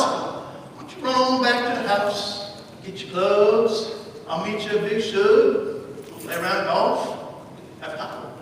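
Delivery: very slow, unhurried Southern drawl; Southern accent. Retold or imitated. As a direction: stretch it out like an old Alabama man. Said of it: "He had this thick Southern accent".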